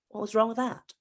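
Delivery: ordinary talk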